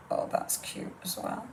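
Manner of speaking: a hushed little gasp of delight; whispered to herself, pleased; soft delighted whisper. Real, not acted. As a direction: breathe it out, charmed by the piece. Delighted aside while crafting.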